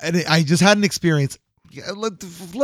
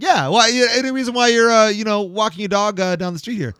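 mock neighbor; 6 semitones higher than usual. Neighbor pressing him, retold.

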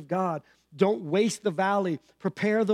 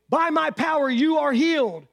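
mock grand healer; 8 semitones higher, 5 dB louder than usual. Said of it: "Jesus didn't say".

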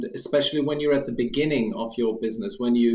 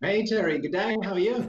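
attempted Australian accent, higher pitched; Aussie accent; 6 semitones higher than usual. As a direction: try an Australian greeting, a bit higher. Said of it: "That was my attempt".

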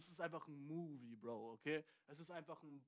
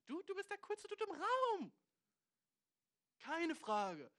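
much higher, louder, slowed-down exclamation; excited hype; 12 semitones higher, 6 dB louder, slower than usual. Real, not acted. Hypes American tourists to viewer, loud high exclamation. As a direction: shout it like crowning a champion.

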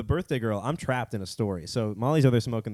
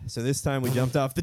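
ordinary delivery

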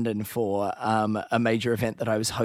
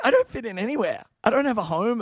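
high, fast, hammed-up lament; mock despair; 10 semitones higher, faster than usual. Host joking about his accent, mock tragic lament. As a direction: play the homeless wanderer for laughs. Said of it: "Like, it was very upsetting".

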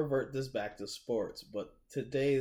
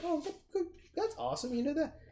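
much higher, sing-song fake enthusiasm; mock cheerful praise. Reacting to a firefighter ad with high mock delight. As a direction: gush over the ad like it's sweet. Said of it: "I'm like".

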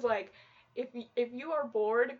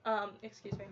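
normal talking voice

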